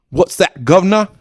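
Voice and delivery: high, loud mock British accent. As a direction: ham up a posh English accent. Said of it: "English is, like, all proper".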